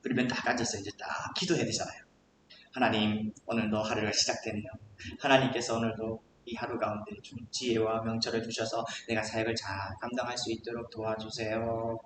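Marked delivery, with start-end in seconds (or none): 2.7-12.0 low, soft, murmured prayer; short morning prayer. Acted. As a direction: pray privately and humbly. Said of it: "이렇게 기도를 짧게 하잖아요".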